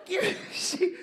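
high whiny mock woman. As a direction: whine it high, hammed up.

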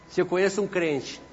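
ordinary talk, nothing marked

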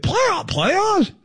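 high-pitched incredulous coach; mock-incredulous rising squawk; Jim Mora. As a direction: echo a coach's disbelieving outburst on PLAYOFFS. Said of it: "a Jim Mora voice".

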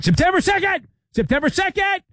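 high-pitched mock yell; excited high holler for an ad. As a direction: shout it way up high, hyping the date. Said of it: "I'm yelling".

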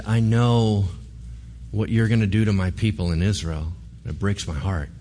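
low, subdued sorrow, no sobbing; understated heartbreak of a retold prophet; quietly grieving. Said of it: "Elisha starts to cry".

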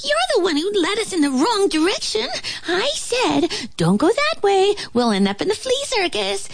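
high, rapid squeaky accusation; indignant flea. A narrator's reading. Flea blames ant for wrong turn, high indignant rattle.

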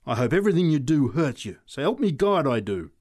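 a furious outburst at the man who shoved her; savage. Acted. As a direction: curse him with real venom. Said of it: "she said savagely".

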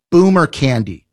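usual speaking voice

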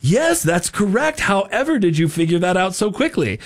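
mock astonishment in an announcer's swooping voice; game show announcer. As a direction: imitate a cartoon quizmaster taking a call. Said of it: "he answers the phone and he says".

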